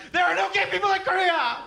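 high, loud, fast mock mom outburst; hammed-up scandalised mother; shouting mom. Comic voicing his mom answering her son, high loud shout. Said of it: "she was like".

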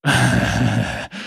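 short, low scoffing laugh; man's laugh. Acted. Thug laughing at a cheeky young woman, low chuckle. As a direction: laugh off the challenge. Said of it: "Der Mann lachte".

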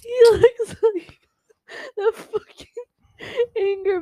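loud, high laughing tease; teasing between laughs. Real, not acted. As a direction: choke it out through laughter. Laughing description.

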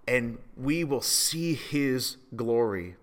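ordinary delivery

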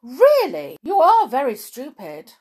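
loud scornful rebuke; angry. A narrator's reading. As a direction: scold loudly, high and cross. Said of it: "said the Mock Turtle angrily".

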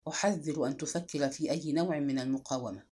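low, quiet firmness; firm and clear. A narrator's reading. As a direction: warn firmly, keeping the voice down. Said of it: "وقال خضر بحزم ووضوح".

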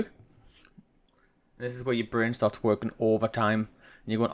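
normal talking voice

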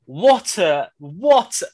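usual speaking voice

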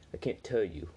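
usual speaking voice

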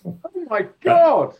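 high, slow, amazed oh my God; astonished gasp. Real, not acted. Host amazed by Princess Diana doing the splits. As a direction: stretch it out in disbelief.

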